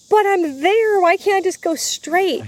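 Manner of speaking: high-pitched, exasperated plea; replayed inner whine of protest; mock protest. Acted protest at the detour.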